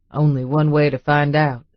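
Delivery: deep, teasing man's voice; low and playful, leaning in; low male voice. A narrator's reading. Boone voiced by narrator.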